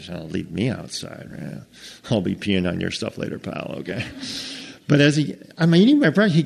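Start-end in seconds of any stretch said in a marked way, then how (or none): 0.1-4.1 much softer, low grumbling threat, last word breathed; the sulking dog mumbling under his breath; quiet, lower dog grumble fading to a breath; muttered dog grumble. Acted.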